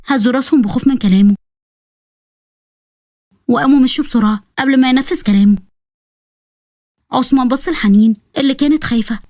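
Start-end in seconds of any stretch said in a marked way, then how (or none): none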